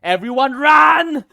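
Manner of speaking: high, loud panicked yell; panicked shout. Acted. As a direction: scream at everyone to flee. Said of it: "Stella goes".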